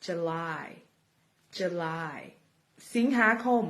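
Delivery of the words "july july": "July" as "juh-LAI", stress on the second syllable; slow, careful "July" with a j sound; correct pronunciation. Shown as a demonstration. Teacher repeating "July" for the class to copy. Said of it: "ไม่ใช่ July แต่เป็น".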